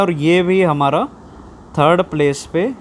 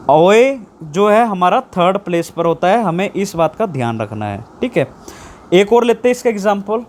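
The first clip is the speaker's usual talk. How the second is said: ordinary delivery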